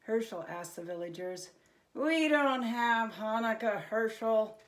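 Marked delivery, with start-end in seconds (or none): 1.9-4.6 sadly. A narrator's reading. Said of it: "one of them answered sadly".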